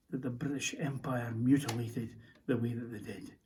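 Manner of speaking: low, choked, quiet bitterness; bitter grief. Acted. Soldier condemning the execution, grieving bitterly. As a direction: let the voice sink and fail on the curse.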